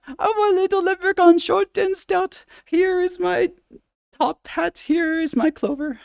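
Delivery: high falsetto Irish leprechaun sing-song; leprechaun accent. Shown as a demonstration. Streamer plays leprechaun for chat in high Irish brogue. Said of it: "why do they all talk an octave higher".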